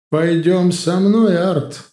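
loud, slow, commanding call; menacing booming voice. Acted. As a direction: boom out the summons like a witch. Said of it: "зазвучал грозный гулкий голос". (грозный гулкий голос)

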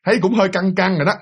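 ordinary delivery, nothing marked

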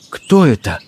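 plain reading voice

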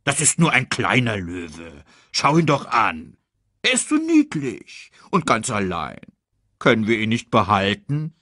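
doting warthog character voice. Acted. Warthog begging meerkat to keep the cub, doting warthog voice. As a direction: coo over the cub and plead.

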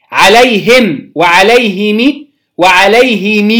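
عليهم slowly as "alayhim, wa-alayhimi, wa-alayhimii"; vary each عليهم: bare m, short i, long ii; three kasra forms. Shown as a demonstration. Teacher recapping three forms, عليهم as him, himi, himii. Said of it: "تلات أوجه في كسر الهاء".

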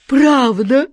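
high, loud joyful question; overjoyed. Acted. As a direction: burst out with delight. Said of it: "Джин подпрыгнул от радости".

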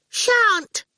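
put-on high, cheeky child's refusal; a little girl refusing flatly; high sassy refusal; defiant brat. A narrator's reading.

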